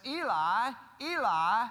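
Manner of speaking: loud, drawn-out imitated cry; mild retold scream. Preacher quoting what Jesus screams, mild imitated scream. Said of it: "What is it that Jesus screams?".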